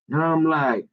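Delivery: normal talking voice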